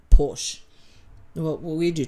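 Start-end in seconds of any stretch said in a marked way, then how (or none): 0.1-0.6 Porsche said as "porsh"; one-syllable Porsche. Shown as a demonstration. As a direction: stop Porsche at the "sh". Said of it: "the English pronounce it Porsche".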